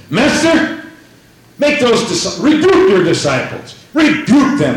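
mock-indignant Pharisee demanding a rebuke; indignant complaint. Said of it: "So they said".

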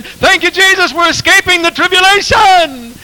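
very high, loud jubilant praise; mock rejoicing crowd. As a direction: cry out like a rejoicing congregation. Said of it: "they're saying".

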